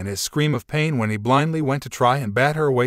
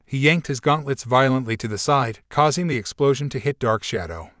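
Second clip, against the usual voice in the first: ordinary delivery, nothing marked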